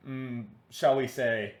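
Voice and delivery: usual speaking voice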